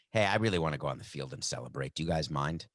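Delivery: low, softened polite asking; meek request. Acted. Player politely asking security to celebrate, meek. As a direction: ask permission mildly, voice dropped low. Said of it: "Maybe it went this way".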